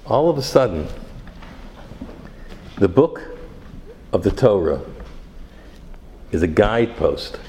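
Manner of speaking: loud, driving sermon cadence; preaching at full force. Acted. Rabbi preaching to his congregation, loud and driving.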